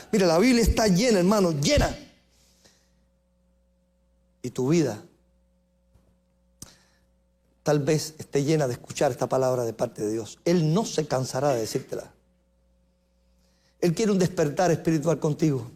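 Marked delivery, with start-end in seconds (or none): 4.4-15.7 soft, low, intimate appeal; tender appeal. Real, not acted. Preacher speaks softly to a weary listener. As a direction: lean in and speak gently to each listener.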